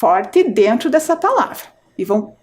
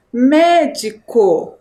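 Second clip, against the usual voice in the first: "Médico" very slow, first syllable strongest; stress on first syllable; 3 dB louder, much slower than usual. Shown as a demonstration. Teacher reads the word to pupils, MÉ in "Médico" stressed and drawn out. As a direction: stretch out "Médico", leaning hard on the MÉ. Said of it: "A sílaba mais forte, médico, é o me".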